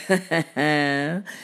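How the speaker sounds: low staged chuckle; short, deliberate ha-ha-ha; cat's chuckle. Acted. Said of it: "laughed the cat".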